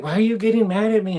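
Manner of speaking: put-on whiny kid. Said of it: "I was like".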